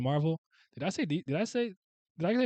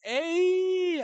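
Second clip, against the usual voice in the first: very high, drawn-out "Ay"; hype "ay". Shown as a demonstration. Explaining the slang to chat, high hype "ay". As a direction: sing out "Ay" like something's hype. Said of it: "You're like".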